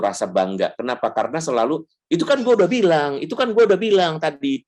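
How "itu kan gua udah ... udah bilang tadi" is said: told-you-so parent. Retold or imitated.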